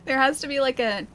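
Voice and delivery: ordinary delivery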